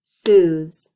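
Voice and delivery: Suze called out loud and long. Shown as a demonstration. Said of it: "You ready?".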